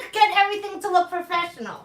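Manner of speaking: ordinary talk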